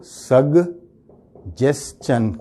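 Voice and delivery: "سگ جس چن" with a stop after the G; broken up. Shown as a demonstration. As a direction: say "سگ جس چن" in separate pieces. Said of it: "مزید توڑ دیتے ہیں". ("سگ جس چن" توڑ توڑ کر)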